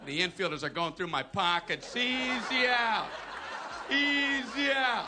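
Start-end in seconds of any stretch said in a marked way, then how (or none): 1.9-5.1 loud, long drawn-out chant; taunting yell. Acted. Fielders close in on the batter, taunting yell. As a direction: stretch each word high and loud. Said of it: "all out there yelling".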